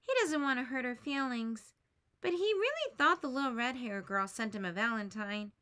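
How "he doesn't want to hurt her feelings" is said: plain reading voice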